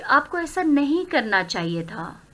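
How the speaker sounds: ordinary talk, nothing marked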